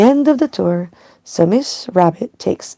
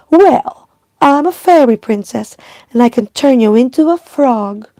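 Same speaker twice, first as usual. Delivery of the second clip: higher and louder indignant cry; loud princess retort; 5 semitones higher, 6 dB louder than usual. Acted. Peppa answers Candy Cat's witch threat with a loud, high cry. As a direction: shout back at the witch. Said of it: "cries Peppa".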